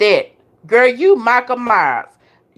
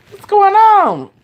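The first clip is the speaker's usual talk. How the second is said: drawn-out call; 9 semitones higher, 5 dB louder, much slower than usual. Real, not acted. Repeats question drawn out.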